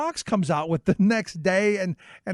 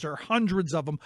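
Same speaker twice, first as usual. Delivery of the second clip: normal talking voice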